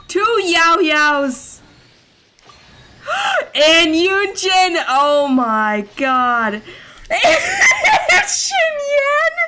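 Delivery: real elated shouting at the screen; ecstatic shouting. Real, not acted. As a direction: scream with joy, laughing. More characters appear in pull.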